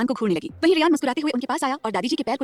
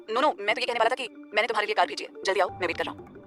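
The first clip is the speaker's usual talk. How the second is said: lower, softer male voice, quick to explain; lower, soft hasty reply; hurried young man; 4 semitones lower, 5 dB quieter than usual. A narrator's reading. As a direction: rush to smooth it over. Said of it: "जल्दी से बोला".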